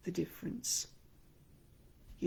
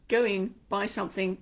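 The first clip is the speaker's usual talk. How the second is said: ordinary talk, nothing marked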